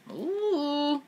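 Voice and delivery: high, drawn-out "ooh"; long high-pitched ooh of reaction; reacting "ooh". Real, not acted. Reacting to the husband's rules.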